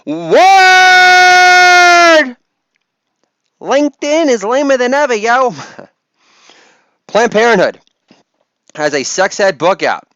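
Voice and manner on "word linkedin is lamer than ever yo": high-pitched rapper shout, first word held long; hammed-up hype, pitched way up. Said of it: "between [name] and [name]".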